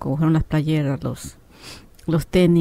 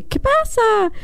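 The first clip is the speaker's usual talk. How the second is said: very high, sustained squeal; drawn-out high mock cry; retold startled cry; much slower than usual. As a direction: mimic the other woman in a high-pitched voice. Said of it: "yo grito".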